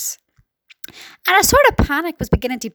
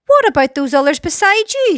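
higher-pitched, mild shout; shouted question; 5 semitones higher, 3 dB louder than usual. A narrator's reading. Chief of police to James, shouted question. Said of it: "shouted the chief of police".

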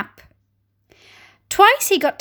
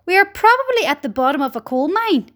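plain reading voice

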